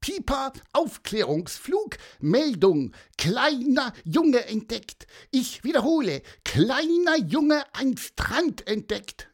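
high squeaky radio-style announcement; called-out bird report. A narrator's reading. Pieper reports the boy he spotted, high official chirp. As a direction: call in the report, shrunk to a tiny high voice. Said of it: "rief Pieper".

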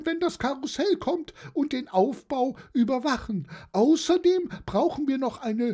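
very high-pitched aunt rattling off chores; high, bustling auntie voice; jolly old aunt, busy. Acted. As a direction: reel off a long to-do list way up high.

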